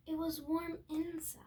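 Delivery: soft, breathy storybook whisper. A narrator's reading. Reading to viewers, drops to a whisper. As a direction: hush it like a secret.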